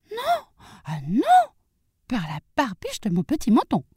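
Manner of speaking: high-pitched piglet refusal; defiant little pig. Acted. Little pig refuses to let the wolf in, high and defiant.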